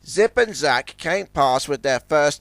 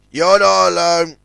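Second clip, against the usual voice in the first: louder mocking jeer; 3 semitones higher, 6 dB louder than usual. A narrator's reading. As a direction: taunt him. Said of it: "jeered Zoran".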